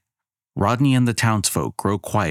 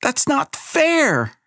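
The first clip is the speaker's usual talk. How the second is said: put-on child shouting; much slower than usual. Abigail shouts at the goddess, high and drawn out. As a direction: shout your outrage. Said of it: "Abigail shouts".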